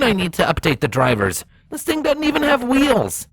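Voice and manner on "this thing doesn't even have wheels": high, fed-up filly; pitched up in disbelief; high exasperated voice. A narrator's reading. Exasperated character on phone.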